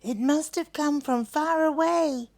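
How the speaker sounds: a thoughtful little echidna. Acted. Echidna wonders aloud.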